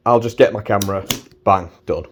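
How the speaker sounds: usual speaking voice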